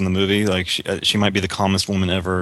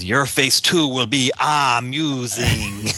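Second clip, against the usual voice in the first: slow, exaggerated fake Middle Eastern accent, pitched up; mocking an actor's clumsy Middle Eastern accent; imitating Silva's accent; 5 semitones higher, much slower than usual. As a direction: drag it out in a hammy put-on Middle Eastern accent. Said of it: "I really noticed his accent when he says".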